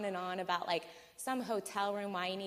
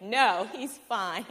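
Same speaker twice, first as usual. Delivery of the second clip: slow, louder, final; firm refusal; 2 semitones higher, 8 dB louder, much slower than usual. Retold or imitated. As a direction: shut the idea down slowly and firmly. Flat refusal to friend.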